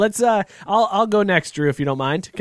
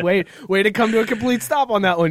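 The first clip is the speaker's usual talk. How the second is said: ordinary delivery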